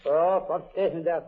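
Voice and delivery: ordinary delivery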